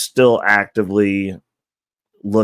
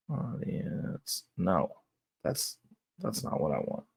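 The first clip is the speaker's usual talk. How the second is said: muttering to self; 14 dB quieter, slower than usual. Real, not acted. Muttering while searching the screen.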